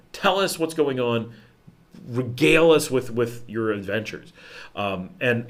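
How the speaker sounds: ordinary talk, nothing marked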